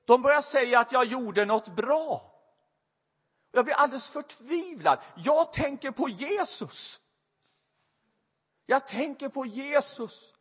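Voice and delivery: high, strained, near-tearful preaching; impassioned preaching. Acted. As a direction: pour out her despair to the congregation. Emotional climax of the testimony. (högt, förtvivlat predikande)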